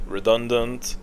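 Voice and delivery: ordinary delivery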